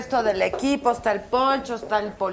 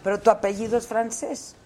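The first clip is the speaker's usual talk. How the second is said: usual speaking voice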